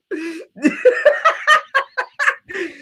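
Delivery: long high laughter. Real, not acted. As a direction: crack up at the vegan steak. Laughs at watermelon steak.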